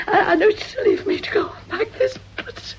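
crying, voice breaking as she begs; tearful pleading. Acted. Frightened lodger begging Mrs Payne through tears. Miss Beringer pleads, persecuted.

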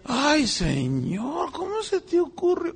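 very slow, drawn-out reverent sigh; reverent awe. Retold or imitated. Preacher and friends marveling at creation, slow reverent sigh. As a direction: breathe it out slowly in wonder. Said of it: "Nosotros decíamos".

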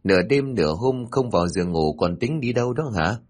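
plain reading voice

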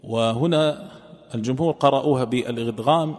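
usual speaking voice

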